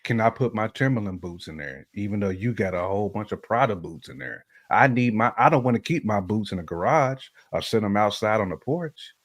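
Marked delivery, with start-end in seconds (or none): none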